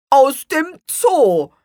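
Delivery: high, slow and loud; kangaroo voice. A narrator's reading. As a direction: draw out the answer. Känguru antwortet. (hohe, langsame Kängurustimme)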